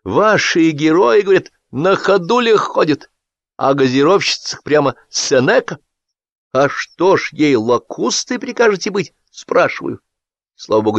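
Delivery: high, sing-song gushing enthusiast; a fawning hack beaming at his comrades; high-pitched over-eager voice; gushing Sapfirov. A narrator's reading. Sapfirov complaining about critics.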